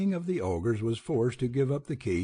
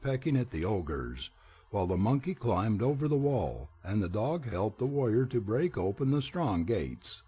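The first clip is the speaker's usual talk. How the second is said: plain reading voice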